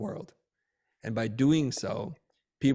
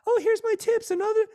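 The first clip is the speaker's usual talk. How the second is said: very high, louder squeaky mock voice; mock a silly high voice you can't take seriously; high-pitched voice; 6 dB louder than usual. Shown as a demonstration. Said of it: "someone that's really high-pitched".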